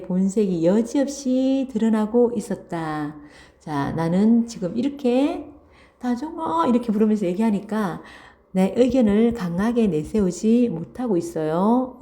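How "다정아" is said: high, sweet call of a friend's name; tender, lilting name-call; affectionate calling. Acted. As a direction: call the name fondly, pitched well up. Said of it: "정말 다정하게 부르면서".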